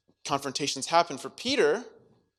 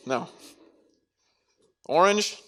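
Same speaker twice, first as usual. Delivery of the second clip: normal talking voice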